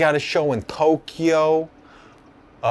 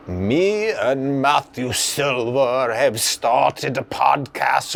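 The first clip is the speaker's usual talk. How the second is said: poem recital; 4 semitones lower than usual. Acted. Performer reciting a poem about his podcast, dramatic spoken word. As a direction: stretch key words and pause dramatically mid-phrase. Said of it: "I just wanna tell you guys a poem".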